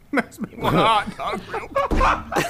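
squeaky giggling voice; laughing through the words. Real, not acted. Cracks up mid-line.

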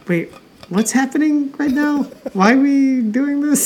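high-pitched, bewildered viewer; mock confusion. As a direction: act out being lost by the plot, voice lifted. Acting out a confused movie viewer.

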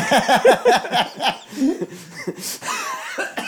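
high burst of laughter; loud laugh. Real, not acted. Laughing at doping joke.

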